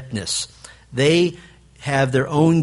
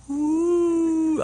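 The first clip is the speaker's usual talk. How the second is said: high mock dazed coo. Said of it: "Dad's just all".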